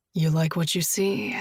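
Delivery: a hint of a low gentle man. Josh asks Julia gently, lightly voiced man.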